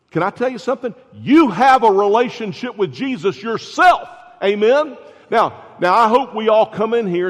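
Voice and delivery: loud, high preaching call to the congregation; rising up, preach it hard at them. Acted. Preaching at full force.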